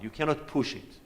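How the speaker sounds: normal talking voice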